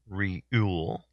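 Reuel said as "REE-yool"; mispronounced Reuel. Real, not acted. Host guessing at a trivia answer's name, Reuel mispronounced as "REE-yool". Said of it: "So I was pronouncing it wrong".

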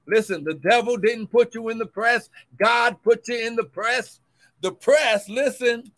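loud, rising sermon cadence; fervent preacher building; preaching at full force. Acted. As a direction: drive the point home to the church. Preaching on the press.

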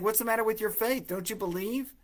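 high, quick "what's wrong with your faith"; gentle challenge. Retold or imitated. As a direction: push him kindly to believe. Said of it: "it's as if he's saying".

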